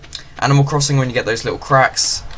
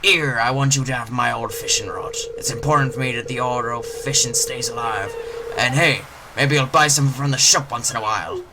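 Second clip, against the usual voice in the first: little pirate voice. Acted. Gamer voicing the fisherman greeting the newcomer as a pirate. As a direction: growl it out like a friendly old pirate. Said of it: "Little pirate voice".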